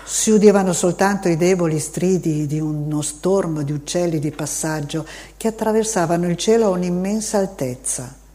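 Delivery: ordinary delivery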